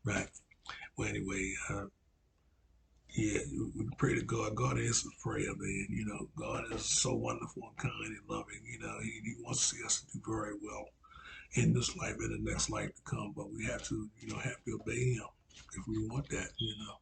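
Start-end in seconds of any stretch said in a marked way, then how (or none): none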